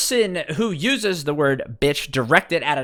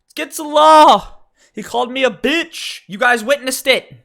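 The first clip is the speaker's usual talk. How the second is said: shrill, much higher and louder outraged woman's voice; outraged wife; 12 semitones higher, 6 dB louder than usual. Acted. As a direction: play the offended wife calling for the cops at full pitch. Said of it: "And she goes".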